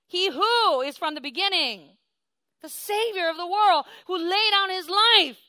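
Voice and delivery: voicing Jesus in a loud, ringing sermon; full-force preaching. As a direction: proclaim it from the pulpit.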